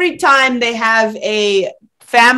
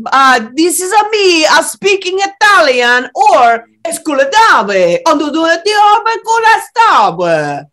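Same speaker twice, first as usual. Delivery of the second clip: mock Italian; 5 semitones higher, 4 dB louder than usual. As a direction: fake Italian gibberish, high and loud. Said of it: "do we speak Italian or no".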